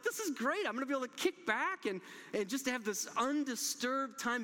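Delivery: delighted private cheer; gleeful inner voice. Retold or imitated. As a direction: pitch it up like you can't believe your luck. Excited thoughts as the door closes.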